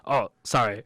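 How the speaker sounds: ordinary delivery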